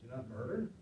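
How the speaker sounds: quiet incredulous rise on murder; unimpressed question. Shown as a demonstration. Disciple underwhelmed by Jesus's commandment, mock disbelief. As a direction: ask it like it's too obvious to bother with. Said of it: "Should I write that down?".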